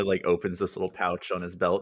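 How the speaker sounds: ordinary talk, nothing marked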